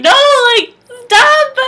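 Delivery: high, drawn-out mock plea; panicked protest. Patient fending off the doctor's touching, panicked protest. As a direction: push it up into a whiny yelp.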